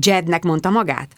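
usual speaking voice

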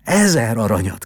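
the student crying out to his dead love, loud; cried out. A narrator's reading. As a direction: call it out with eager passion. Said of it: "kiáltott a diák".

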